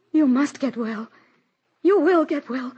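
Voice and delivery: rising loud then slow, breaking plea; desperate pleading. Acted. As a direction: beg him to live. Mother pleading with dying son.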